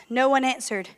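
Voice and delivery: ordinary talk, nothing marked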